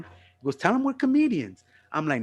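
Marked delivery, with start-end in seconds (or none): 0.6-1.6 high-pitched urging; imitated insistent friend. Passenger Momo urges driver about the cop, insistent.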